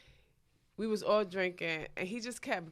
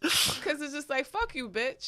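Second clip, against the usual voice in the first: higher, laughing through the retort; 7 semitones higher, slower than usual. Real, not acted. Retelling a late-night fight to cohosts, laughing. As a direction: crack up while retelling the insult.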